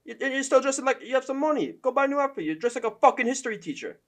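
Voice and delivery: high, loud mock British accent; Michael Bisping accent. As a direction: put on a hammed-up British accent. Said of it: "I can't do his accent".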